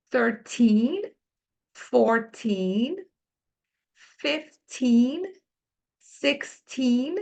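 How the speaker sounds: put-on slow clear English teen numbers; each number drawn out with a rising tune; teacher modelling numbers. Shown as a demonstration. Teacher drills the teen numbers for Brazilian learners, slow and clear. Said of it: "olha só".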